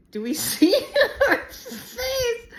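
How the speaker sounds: high, squeaky laughing words; real laughter. Real, not acted. Vlogger showing off her dog's face, laughing. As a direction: crack up as you ask, pitch shooting up.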